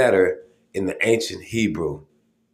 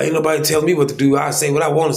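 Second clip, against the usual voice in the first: quick, higher mock of a defiant know-it-all; mocking stubborn people; 6 semitones higher, 3 dB louder, much faster than usual. Mimicking the people who won't listen, fast and mocking. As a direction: rattle off a stubborn know-it-all's retort, fast. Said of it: "A lot of people are".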